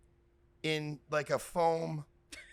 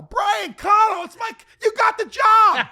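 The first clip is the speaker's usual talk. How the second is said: hammed-up high, loud shout of delight; ecstatic recognition; 13 semitones higher, 11 dB louder than usual. Interviewer hiring on the spot over a name drop, ecstatic shout. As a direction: erupt at the magic name, slow and booming.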